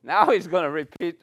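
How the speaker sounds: ordinary talk, nothing marked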